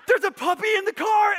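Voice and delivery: high, loud squeal of delight; imitated children's gleeful yell; excited kids shouting. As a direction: shriek it like thrilled children. Kids abandon training over a puppy.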